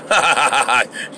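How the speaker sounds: put-on scoffing laugh; mocking laugh. Doubters laughing at Noah's plan, mocking. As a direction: laugh derisively at a fool, hammed up.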